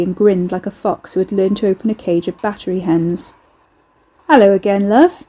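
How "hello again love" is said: leering Cockney voice; a cheeky London leer; Cockney accent. Acted. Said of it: "he leered in a passable Cockney accent".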